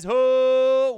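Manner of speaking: long, very loud high whoop; big drawn-out hype call; celebratory shout. Real, not acted. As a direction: belt out a sustained "ho". Hyped thanks for a donation.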